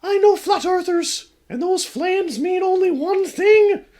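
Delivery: put-on high slow fearful girl; despairing ghost girl. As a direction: ghost girl seeing the flames coming. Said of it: "her face soon drops in despair".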